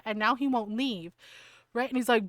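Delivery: ordinary talk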